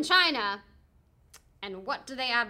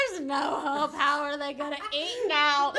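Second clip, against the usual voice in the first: raised, louder voice of exaggerated despair; mock despair; 4 semitones higher, 5 dB louder, slower than usual. Host retorts to the film's hopeful ending, in mock despair. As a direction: argue back at the film in distress.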